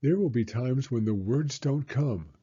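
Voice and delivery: ordinary delivery, nothing marked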